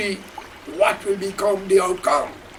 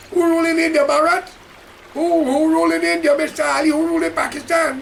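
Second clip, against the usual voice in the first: fiery preaching; 6 semitones higher than usual. Acted. Street preacher firing questions at viewers, fiery preaching. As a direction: preach it, pounding each question.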